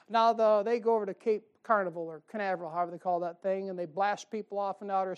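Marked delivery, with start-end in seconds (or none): none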